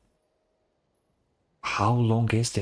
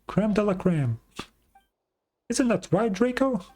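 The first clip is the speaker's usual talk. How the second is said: high, eager little companion voice; squeaky companion; 10 semitones higher than usual. Acted. Little companion asks chief for blessing, high and eager. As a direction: pipe up like a tiny mascot.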